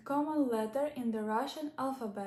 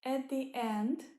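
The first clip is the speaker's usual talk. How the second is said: usual speaking voice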